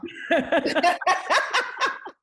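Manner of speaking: a long, high, loud laugh; host laughing at her own joke about the guest. Real, not acted. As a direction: laugh hard after the tease. Laughs after teasing.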